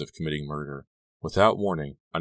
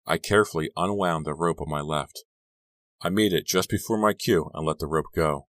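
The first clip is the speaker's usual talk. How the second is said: usual speaking voice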